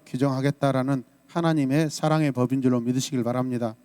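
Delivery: ordinary delivery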